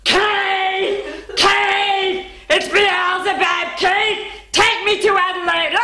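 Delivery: high-pitched manic shouting, breaking into laughter; crazy woman. Retold or imitated. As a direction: play a madwoman going off as a joke. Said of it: "this crazy woman that's going off".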